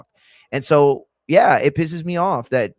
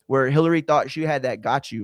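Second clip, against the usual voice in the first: normal talking voice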